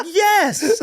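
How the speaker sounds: high, laughing squeaky yes; mock girlfriend yes. Girlfriend answering about the cups, high squeaky yes. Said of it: "She's like".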